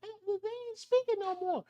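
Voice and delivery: high, hushed gossiping coworker voice; high mock whisper of coworkers. Coworkers gossiping about him in a high hushed voice. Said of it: "They be like".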